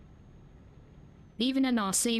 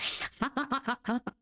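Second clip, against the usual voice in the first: real laugh; 3 semitones higher than usual. Real, not acted. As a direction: burst of embarrassed laughter. Laughs at ditch mishap.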